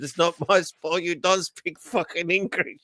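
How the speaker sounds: high-pitched mock Indian-accented English; imitated Indian accent. Imitating an Indian customer cracking it at the server, mock Indian accent. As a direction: put on an indignant Indian accent. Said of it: "And the Indian woman said".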